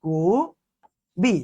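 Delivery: কবি stretched very slowly, short ই at the end; drawn-out demo. Shown as a demonstration. Teacher to students, drawing out কবি. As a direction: draw out কবি to show the vowel. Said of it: "এটার উচ্চারণ হচ্ছে".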